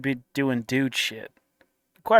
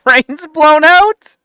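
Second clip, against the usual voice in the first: can't believe what he just heard; incredulous disbelief; 10 semitones higher, 11 dB louder than usual. Real, not acted. Repeating the clip's claim back in loud, high disbelief.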